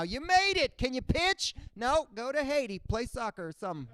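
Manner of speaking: hammed-up Coast Guard officer; mock border guard. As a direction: bark it like a cheery guard.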